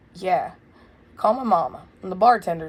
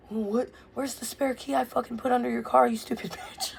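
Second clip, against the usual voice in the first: jab the insult, pitched up; angry yelling; 6 semitones higher, 5 dB quieter than usual. Retold or imitated. Furious son berating mom about the spare key, pitched-up rant. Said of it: "So I'm screaming at her on the phone".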